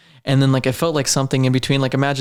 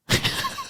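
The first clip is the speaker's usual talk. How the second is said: awkward laugh; 9 dB quieter than usual. Real, not acted. Awkward look at camera.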